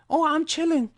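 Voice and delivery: put-on high, light girlish reply; girl's reply. Coach voicing the girl who answers, high and girlish. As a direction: answer brightly like a girl being approached. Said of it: "She's respond".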